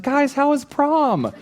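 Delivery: very high, slow, sing-song sweet mom voice; imitating a cheery mother in a slow high voice. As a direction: greet the kids like the sweetest mother alive. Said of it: "She's like".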